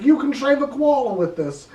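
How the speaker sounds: mock Australian. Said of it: "And I was like".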